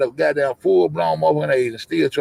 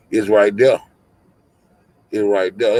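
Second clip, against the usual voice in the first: ordinary talk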